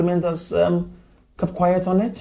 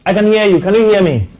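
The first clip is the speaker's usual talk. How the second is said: loud, high, checking the line; calling to caller; 8 semitones higher, 8 dB louder, faster than usual. Real, not acted. Host checks that the caller can hear, calling out. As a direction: project hard into a bad connection.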